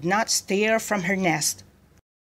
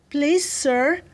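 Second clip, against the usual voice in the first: pleading underling; 7 semitones higher, 3 dB louder than usual. A narrator's reading. Gunner pleads with angry general.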